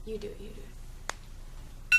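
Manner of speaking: whispered plea; whispering. Real, not acted. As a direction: whisper it, slow and pleading. Pleading, mint smells.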